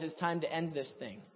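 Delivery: soft, low voice of God; quiet summons. Retold or imitated. The Lord calling Elijah out of hiding, quiet and low.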